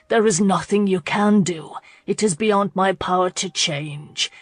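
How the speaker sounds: plain reading voice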